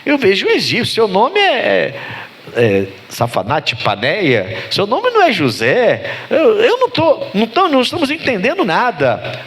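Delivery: high-pitched, baffled protest; stunned disbelief. Acted. Brothers facing an Egyptian who claims to be Joseph, baffled disbelief. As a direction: act out jaw-dropped brothers who can't make sense of it. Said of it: "o queixo caiu dos irmãos".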